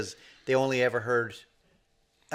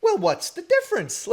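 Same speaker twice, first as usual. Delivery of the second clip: much higher, eager mock voice of the apprentice; curious young guy; 4 dB louder than usual. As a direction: squeak it out like a naive kid asking.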